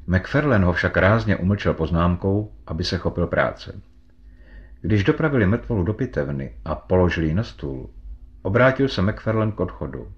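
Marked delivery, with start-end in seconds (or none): none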